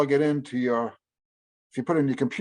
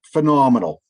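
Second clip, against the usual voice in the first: emphatic praise; 8 dB louder than usual. Real, not acted. Enthusing about the books.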